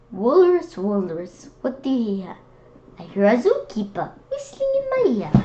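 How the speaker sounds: lively, sing-song picture-book read-aloud; reading to children. Acted. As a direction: read it like storytime for little kids.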